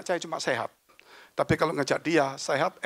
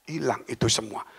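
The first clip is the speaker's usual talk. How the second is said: normal talking voice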